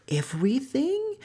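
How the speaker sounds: high breathy "Everything?" rising; sly surprise. Acted. Loki in disguise presses Frigga, high sly whisper.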